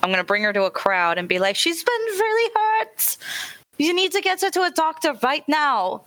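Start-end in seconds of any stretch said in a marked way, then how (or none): 1.6-6.0 high-pitched, urgent plea for help. Acted. Bringing an injured girl to a crowd, urgent plea. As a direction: plead urgently, pitched well up.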